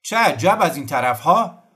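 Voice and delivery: high, loud hail; shouted greeting. A narrator's reading. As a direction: call out to passing soldiers. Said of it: "کوزیمو کلاه از سر برداشت و داد زد".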